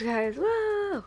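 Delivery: high, sudden delighted whoa; a jump-up-high little shriek of surprise; surprised whoa. Real, not acted. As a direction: gasp it out as you spot the blush. Finding lost blush mid-makeup.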